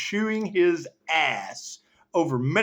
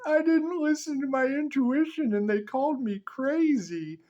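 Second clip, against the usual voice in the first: higher voice for the client's complaint; a lightly voiced whiny client; imitating someone who was called crazy; mimicking a client; 9 semitones higher than usual. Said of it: "Josh wasn't listening".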